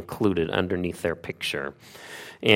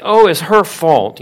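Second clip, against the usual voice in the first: mock whiny excuse; 7 semitones higher, 8 dB louder than usual. Mock excuse David didn't make.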